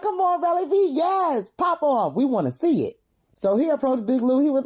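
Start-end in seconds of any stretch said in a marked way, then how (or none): 0.0-3.0 high-pitched hyped cheering; excited heckle, starting high and easing down; egging him on. Retold or imitated. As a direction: goad a fighter on, pitched way up. Egging on a fighter.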